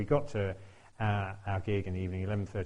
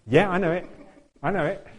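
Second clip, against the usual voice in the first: bluffing assurance; 6 semitones higher, 5 dB louder than usual. Retold or imitated. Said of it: "And I'm like".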